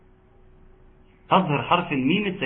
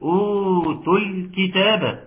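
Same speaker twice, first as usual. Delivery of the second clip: higher, slow chanted recitation; Quran recitation; 5 semitones higher, slower than usual. Shown as a demonstration. Teacher recites for students to judge, higher slow recitation. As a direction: chant it slowly at a raised pitch.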